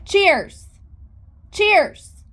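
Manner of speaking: high, loud call; sharp call. Real, not acted. Calling sharply at the horse.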